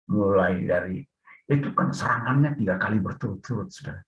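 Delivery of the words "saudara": ordinary talk, nothing marked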